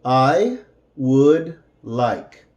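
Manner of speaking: "I would like" said slowly, each word apart; careful, slowed teaching delivery; slow separated words. Shown as a demonstration.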